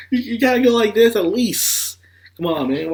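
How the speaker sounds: exasperated coaching. Real, not acted. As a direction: urge him on, fed up.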